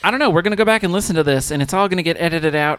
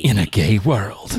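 low, slow trailer announcer, laughing; deep dramatic movie trailer voice; 9 semitones lower, slower than usual. Acted. Parody of trailer voice.

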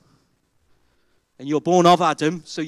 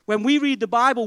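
ordinary talk, nothing marked

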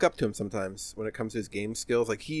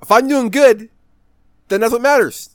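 loud, high indignant protest; heated rant; 9 semitones higher, 11 dB louder than usual. Real, not acted. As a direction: defend yourself louder and louder. Heated defensive rant about match.

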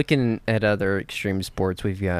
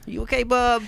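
much higher pet voice; talking to the dog; 11 semitones higher than usual. Real, not acted. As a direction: coo at the dog.

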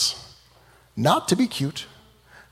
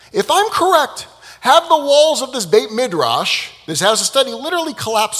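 much higher, louder, pushing harder; rising frustration; 9 semitones higher, 4 dB louder than usual. Acted. Rabbi Eliezer demanding the walls fall, frustrated. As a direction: raise the stakes in exasperation. Rabbi escalating his challenge.

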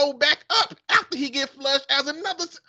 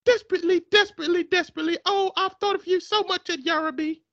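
put-on woman's British accent; 6 semitones higher, faster than usual. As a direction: purr it back like a smitten English lady. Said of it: "man and a woman accent".

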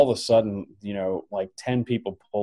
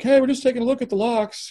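high, breezy mock-innocent voice; innocent tourists; 14 semitones higher than usual. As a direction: sound harmlessly chipper. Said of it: "We're like".

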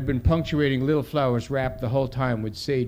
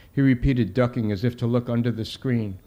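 ordinary delivery

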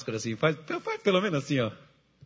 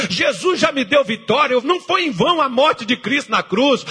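loud, driving pulpit cry, rising higher; fiery preaching; 8 semitones higher, 5 dB louder than usual. Acted. Pastor urging the congregation to leave the past, loud fiery preaching. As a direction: preach it hard to lift the congregation.

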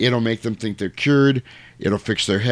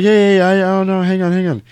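high, louder hey wait; calling after him; 9 semitones higher, 6 dB louder than usual. Retold or imitated. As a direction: call after someone walking off. Cop chasing priest, calling.

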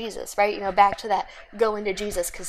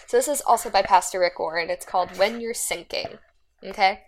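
ordinary talk, nothing marked

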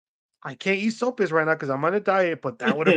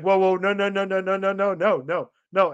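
frantic denial; 4 semitones higher than usual. Retold or imitated. Retelling his frantic denial of racism.

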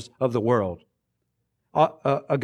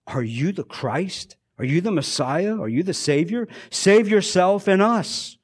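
hurling abuses; 6 semitones higher than usual. A narrator's reading. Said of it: "was hurling abuses at Jesus".

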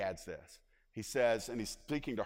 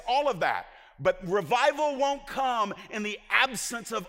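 high, loud pulpit delivery; preaching at full force; 8 semitones higher, 5 dB louder than usual. Real, not acted. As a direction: drive each phrase home to the congregation.

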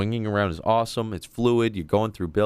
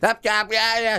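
high mock shout stopping them; mock guard's high yelp; retold yell; 3 dB louder than usual. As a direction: imitate a staff woman calling them back, high. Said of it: "she goes".

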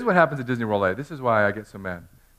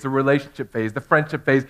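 ordinary delivery

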